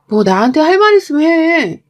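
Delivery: confronting. Acted. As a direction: demand it out loud, fed up with the evasion. Wife confronts evasive husband.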